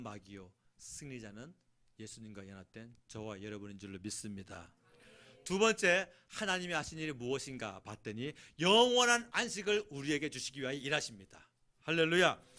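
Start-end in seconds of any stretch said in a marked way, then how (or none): 5.4-12.4 loud, high revival preaching; booming sermon climax; full-force preaching. Acted. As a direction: preach it out to the whole hall.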